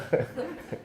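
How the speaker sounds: quick soft high laugh; soft delighted laugh. Real, not acted. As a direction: chuckle with delight. Laughs again.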